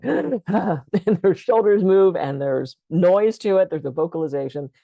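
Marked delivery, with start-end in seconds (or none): 0.0-2.2 a sharp, noisy gasp in, slowed right down; demo startled gasp. Shown as a demonstration. Showing the class a tense, noisy first breath, sharp demo gasp. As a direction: show the panicked gasp people make on a first breath. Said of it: "a lot of people going".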